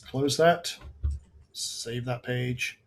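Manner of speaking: normal talking voice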